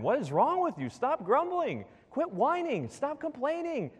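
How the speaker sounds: rising high, fed-up rebuke; exasperated scolding. Retold or imitated. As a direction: scold them like a frustrated father. James scolding the grumbling church.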